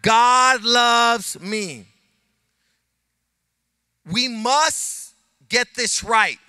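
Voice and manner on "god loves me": slow, loud, stressing God; slow call and response. Shown as a demonstration. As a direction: lead the crowd, each word spaced. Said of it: "Say with me".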